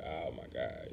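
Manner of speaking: reading a label and finding honey, low and quiet; low dismayed groan. Retold or imitated. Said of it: "and it's like".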